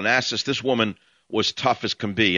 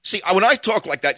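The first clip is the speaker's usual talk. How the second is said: usual speaking voice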